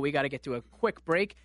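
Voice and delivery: ordinary delivery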